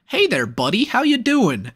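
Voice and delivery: cheesy friendly greeting, rising high; mock friendly. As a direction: play it like a smug pal. Wrestler mockingly greets his opponent.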